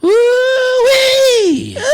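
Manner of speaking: very high, loud holler; hammed-up country "woo-wee" cry; cowboy whoop. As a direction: let out a gleeful rodeo whoop.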